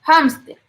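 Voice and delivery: hámster begun with a Spanish J sound; "hámster" with a strongly aspirated H. Shown as a demonstration. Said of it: "suena como J".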